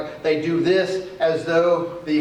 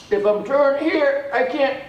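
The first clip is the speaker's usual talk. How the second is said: muffled voice; 9 semitones higher than usual. Real, not acted. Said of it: "you may not be able to hear my muffled voice".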